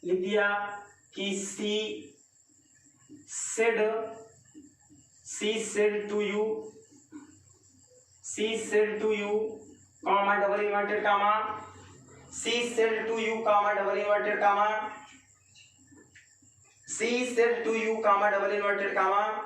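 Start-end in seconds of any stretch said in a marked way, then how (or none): 1.4-9.6 each word drawn out for the class; slow dictation. Shown as a demonstration. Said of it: "dictating slowly". 10.0-19.4 spelling out the punctuation slowly; slow dictation. Shown as a demonstration. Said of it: "dictating punctuation".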